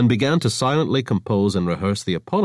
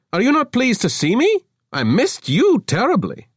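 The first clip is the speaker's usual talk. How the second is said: much higher, louder wheedling pout; 5 dB louder than usual. Acted. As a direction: pout like a wounded charmer. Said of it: "Avra pouted".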